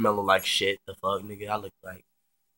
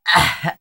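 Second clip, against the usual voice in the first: happy squeal; 8 semitones higher, 11 dB louder than usual. Retold or imitated. Delighted squeal.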